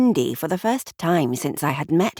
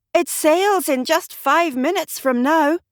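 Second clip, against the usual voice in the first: panicked; 11 semitones higher, 3 dB louder than usual. Acted. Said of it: "panic in his voice".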